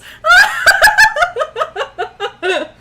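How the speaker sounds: high, loud burst of laughter; real laughter. Real, not acted. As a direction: crack up in a long high cackle. Cracks up at own joke.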